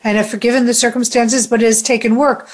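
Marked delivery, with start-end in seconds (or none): none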